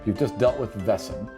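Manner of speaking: normal talking voice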